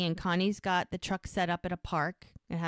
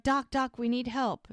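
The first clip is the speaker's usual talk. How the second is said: higher-pitched, lightly acted urgent plea; retold call for help; 6 semitones higher than usual. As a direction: imitate someone calling the doctor over for help. Wife calls doctor for help.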